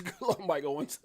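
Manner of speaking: ordinary talk, nothing marked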